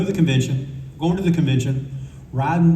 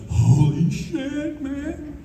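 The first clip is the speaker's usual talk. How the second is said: high, drawn-out mock awe; slow, high-pitched starstruck gasp of words; awestruck imitation; 12 semitones higher, much slower than usual. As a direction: stretch it out high like a stunned fan, fading on the last word. Starstruck Bernie acted out.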